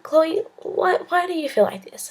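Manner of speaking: gentle priest. Retold or imitated. Priest asking Chloe why she feels unworthy, gentle. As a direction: ask like a kind worried priest.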